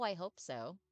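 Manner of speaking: ordinary talk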